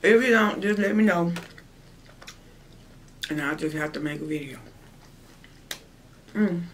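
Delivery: talking with food in mouth; mid-bite casual speech; chewing while talking. Real, not acted. Seen 2: Full cheeks and chewing jaw while talking; she keeps eating throughout.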